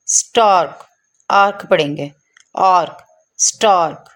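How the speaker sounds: स्टार्क with vowel rounded toward "aw"; isolated teacher's आर्क with "o" colour; "o" sound drill. Shown as a demonstration. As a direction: model आर्क, then स्टार्क, rounded and falling. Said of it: "ओ करके आवाज़ निकलेगी".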